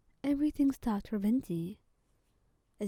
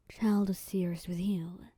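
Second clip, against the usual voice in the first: usual speaking voice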